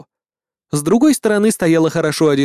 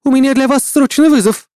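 brisk official gnome lady; 9 semitones higher, 5 dB louder than usual. A narrator's reading. Gnome lady formal summons.

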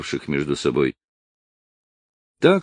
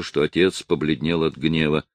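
usual speaking voice

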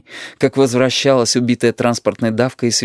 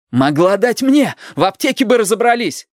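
acted indignant grandson, raised pitch; angry reproach; 11 semitones higher than usual. A narrator's reading. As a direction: throw the blame back at grandma.

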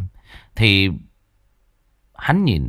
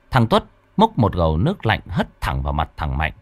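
ordinary delivery, nothing marked

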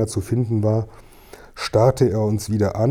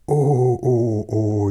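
drawn-out moaning "oh"s; wailing; 2 semitones higher, much slower than usual. A narrator's reading. Old man horrified by the name he heard, wailing. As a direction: moan in dismay, sliding downwards. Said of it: "jammerte er".

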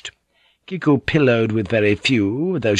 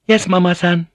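high, sweet courtesan voice; high-pitched obedient young woman; 9 semitones higher, 4 dB louder than usual. A narrator's reading. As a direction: answer in a high girlish voice.

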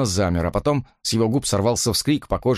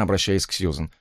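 ordinary delivery, nothing marked

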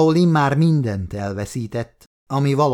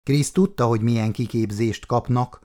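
ordinary delivery

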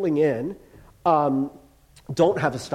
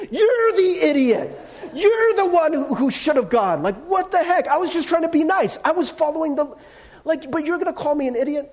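indignant rant; 11 semitones higher than usual. Retold or imitated. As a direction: replay the argument in your head, outraged. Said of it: "I was like".

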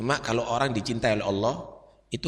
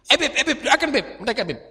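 eager tattling; 10 semitones higher than usual. Retold or imitated. Eager informants pointing out thugs.

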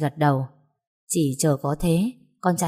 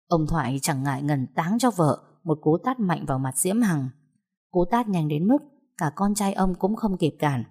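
ordinary delivery, nothing marked

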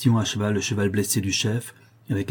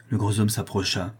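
plain reading voice

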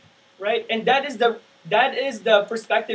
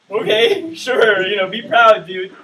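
dismissive; 7 dB louder, slower than usual. Retold or imitated. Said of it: "And everyone's just like".

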